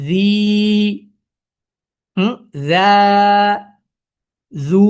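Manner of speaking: জি, যা, জু each drawn out freely; long open vowels on জি, যা, জু; smooth madd. Shown as a demonstration. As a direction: stretch the vowels of জি, যা, জু with no catch. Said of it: "সাবলীল".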